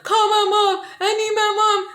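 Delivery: very high, loud mock wail; retold freak-out. Panicking teen cries out for mom, hammed-up freak-out. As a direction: plead like a panicking kid, hammed-up. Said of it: "I'm freaking out".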